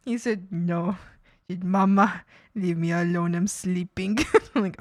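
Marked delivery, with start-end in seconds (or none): 0.5-4.2 a lower grumbly pet voice; grumpy dog voice. Pretend. Voicing the dog.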